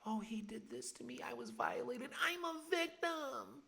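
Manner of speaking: high, whiny mock-victim voice, slowing on the last line. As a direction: parody someone playing the victim. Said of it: "All these people".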